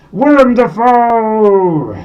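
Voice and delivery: loud, high, slow announcement of the name; loud, slow, high reveal; big reveal. Acted. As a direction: announce the winner like a game show host. Number one reveal.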